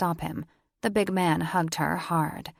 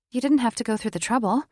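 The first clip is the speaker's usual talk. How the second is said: flustered; 6 semitones higher, 4 dB louder, much faster than usual. A narrator's reading. Amara flustered, fast.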